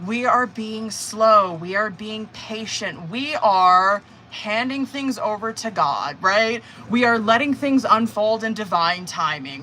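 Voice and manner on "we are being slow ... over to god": slow, deliberate, spaced-out phrasing; slow and patient. Real, not acted. Reader explaining Temperance's patience, slow and measured. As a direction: take each phrase patiently, stretching it out.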